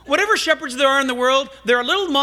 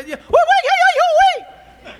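much higher, louder yelping call; shepherd in Israel hollering to his sheep, high and loud; ridiculous shepherd call; 7 dB louder than usual. Retold or imitated. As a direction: yodel it out like a shepherd calling his flock. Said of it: "they're really ridiculous sounds".

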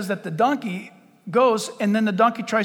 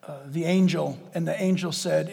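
normal talking voice

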